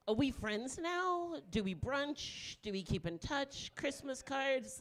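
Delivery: "friends", "brunch", "touch", "cards" each stretched out as a question; slow questions, lingering on each last word; mock earnest questioning. Comic wonders what follows a gangbang, slow mock-earnest questions.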